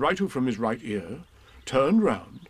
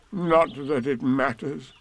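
slow, doubtful donkey; Eeyore; slower than usual. A narrator's reading. Eeyore doubts the morning is good, slow gloomy voice. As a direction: drag it out dolefully. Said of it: "he said".

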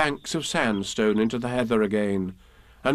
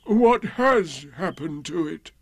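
higher, lightly mournful donkey; Eeyore, gloomy; 4 semitones higher than usual. A narrator's reading. Donkey questioning Pooh about his tail, lightly gloomy. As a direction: puzzle over it dolefully. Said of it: "said Eeyore".

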